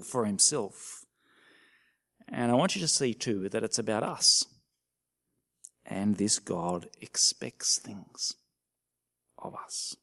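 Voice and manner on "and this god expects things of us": drops to a hush, slow. Real, not acted. To the congregation, a slow hushed warning. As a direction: let it fall to a near whisper.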